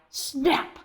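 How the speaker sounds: usual speaking voice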